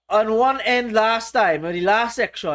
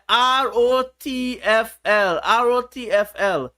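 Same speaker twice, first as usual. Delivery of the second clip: letter by letter, drawn out; slow spelled letters; slower than usual. Shown as a demonstration. Teacher reads acronym to the chat, slow and spelled. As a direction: spell out each letter slowly. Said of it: "What is that about?".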